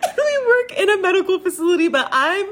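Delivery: high, loud, hurried laughing speech. Real, not acted. Admitting she is no medic at work, laughing. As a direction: blurt it out while cracking up. Cracking up telling her choking story.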